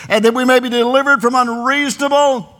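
high, ringing preacher's voice; forceful scripture reading. Acted. Preacher reading scripture to his congregation, forceful preaching. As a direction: declaim the verse loud and high.

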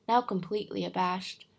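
ordinary delivery

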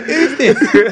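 loud high voice through laughter; laughing mock shout. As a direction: call out loudly, laughing. Joking mock challenge to guest.

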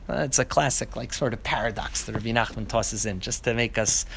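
ordinary delivery, nothing marked

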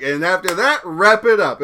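a hint of a cheesy commercial voice; mock ad read. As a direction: sell the punchline like a radio sponsor spot. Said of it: "did an advertisement read for a condom".

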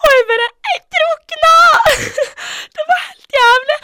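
high, loud, half-crying laugh; shrieking through laughter; laughing through tears. Real, not acted. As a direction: wail it out while laughing. Laughing-crying retelling of panic.

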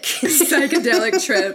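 loud laughing, slow. Real, not acted. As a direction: laugh loudly while saying it. Joking about the psychedelic trip.

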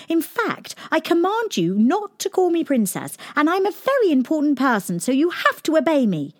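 high, haughty royal order; a little princess pulling rank, fast at the end; bossy command. A narrator's reading. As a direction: lord it over him.

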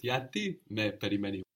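usual speaking voice

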